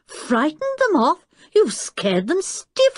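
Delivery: high, slow astonished exclaim; amazed. A narrator's reading. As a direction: marvel at it. Amazed brother.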